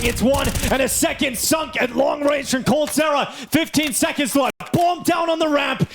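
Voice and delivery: high, shouted esports call; shouted play-by-play. Acted. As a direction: scream the round home. Live play-by-play climax.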